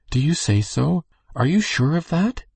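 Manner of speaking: high, astonished questioning; crying out. A narrator's reading. Minister's wife questioning Ranald's news, crying out. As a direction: ask in surprise, pitch climbing high at the end. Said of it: "she cried".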